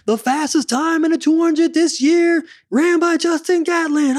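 high, drawn-out stadium call; intercom announcer. Acted. Meet announcer calling out the fastest time, high stretched announcer voice. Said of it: "they said it on the intercom".

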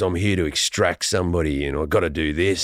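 deep Australian action-hero voice; mock a low, tough movie hero; Chris Hemsworth. Action hero come to extract somebody, deep put-on voice. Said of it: "Hemsworth comes in, he goes".

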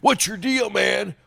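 a nasal-free, high mock \"what's your deal\"; imitating the cop. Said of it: "the guy who said".

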